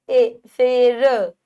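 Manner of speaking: शेर with ए held slow and loud; drawn-out e. Shown as a demonstration. Teacher stretching शेर to show ए. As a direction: draw out the ए in शेर. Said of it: "ए की ध्वनि आ रही है".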